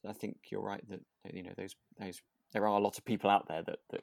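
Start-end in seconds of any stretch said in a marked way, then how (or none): none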